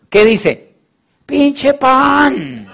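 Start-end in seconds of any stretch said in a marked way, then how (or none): 1.2-2.7 mocking young man. Young man mocks his bread business.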